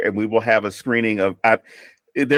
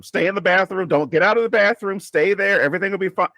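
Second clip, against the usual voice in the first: mom talking to the screen; 8 semitones higher than usual. Retold or imitated. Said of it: "She's like".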